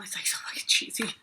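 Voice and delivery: breathy whispered aside, half to herself. Real, not acted. To the viewers about city people, a whispered aside. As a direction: drop to a whisper for the snarky bit.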